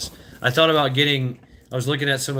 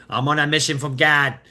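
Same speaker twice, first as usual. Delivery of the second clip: put-on Boston accent; 2 semitones higher, 3 dB louder than usual. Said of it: "you do it in that, that Boston accent".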